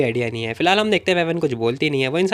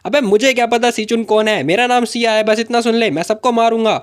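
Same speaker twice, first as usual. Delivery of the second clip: heated, higher-pitched outburst; angry threat; 6 semitones higher, 4 dB louder than usual. A narrator's reading. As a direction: snap back and threaten them all. Angry threat during a scuffle. (गुस्से में ऊँची आवाज़ में धमकी)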